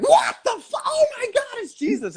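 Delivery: very high mock-startled yelp, then easing down; hammed-up shock, squeaking high; shocked exclamation. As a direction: act out a doubter caught off guard. Thomas shocked as Jesus walks in.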